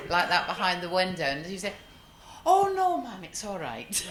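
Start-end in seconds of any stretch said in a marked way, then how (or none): 2.5-4.1 bad Scottish accent. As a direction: imitate a Scot, badly. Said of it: "that was a very bad Scottish accent".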